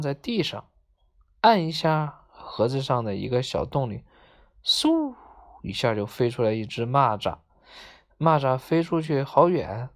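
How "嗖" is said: high, drawn whoosh; whoosh sound. A narrator's reading. Narrator voices the toy launching, high whoosh. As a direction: imitate something shooting out of a box. 模仿蚂蚱弹飞的声音.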